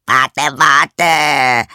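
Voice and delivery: loud, high frog cry with a drawn-out end; frog calling out. Acted. Frog calls after the fleeing princess, desperately loud. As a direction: call after someone as a frog, building. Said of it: "rief der Frosch".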